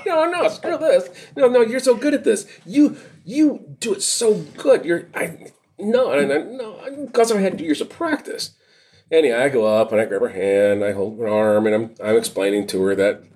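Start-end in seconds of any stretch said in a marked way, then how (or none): 0.1-8.5 high, laughing, wheedling mock voice; mock battalion chief. Cop mimics the battalion chief begging off, high and laughing. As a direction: ham up the chief passing the buck.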